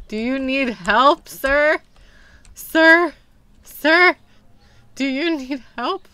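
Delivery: high, loud, insistent questioning; calling out. Pretend. Shopkeeper to silent customer, insistent loud calling. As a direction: shout to get an unresponsive person's attention.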